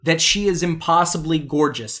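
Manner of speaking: ordinary talk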